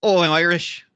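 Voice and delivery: hammed-up stage Irish accent; mock Irish accent. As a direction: overdo the movie's Irish brogue. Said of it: "how over the top that Irish accent was".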